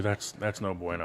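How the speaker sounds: low, soft murmur; hushed dismay. Pretend. Host reacting to the Dodgers' losing record, low and hushed. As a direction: say it low under your breath.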